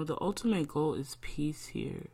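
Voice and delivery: ordinary talk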